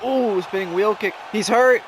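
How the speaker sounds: rising, urgent call of a big hit; excited play-by-play. Real, not acted. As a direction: blurt it out as the fighter is rocked. Big hit in live commentary.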